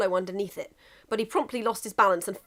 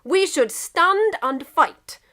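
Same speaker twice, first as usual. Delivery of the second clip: slow, high declaration in Russia's voice; high, slow, proud announcement; declaring; 8 semitones higher, 7 dB louder, much slower than usual. Acted. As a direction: declare it slowly like a drunk general. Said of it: "Russia declared".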